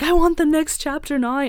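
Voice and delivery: high, loud eager plea; demanding fan. Retold or imitated. Acting out eager fan comments.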